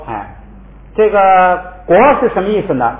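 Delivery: usual speaking voice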